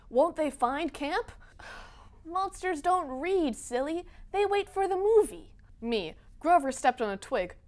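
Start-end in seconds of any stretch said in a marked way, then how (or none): 2.3-5.5 condescending. Acted. Author teasing a fan about monsters, condescending. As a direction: explain it as if to a silly kid.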